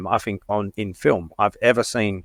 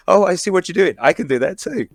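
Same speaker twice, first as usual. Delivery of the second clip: lightly voiced, higher cheery mock Deadpool; Nice Pool impression; 7 semitones higher than usual. A fan quoting Nice Pool to his co-hosts, light mock voice. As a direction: play the smug nice version, a bit higher. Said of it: "When he says the nice Paul".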